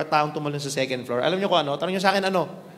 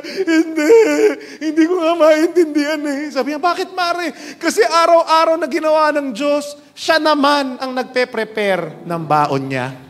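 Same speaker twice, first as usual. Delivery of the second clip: high, loud hammed-up sobbing widow; mock crying; 7 semitones higher, 5 dB louder than usual. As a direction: sob your confession. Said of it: "Umiyak lalo".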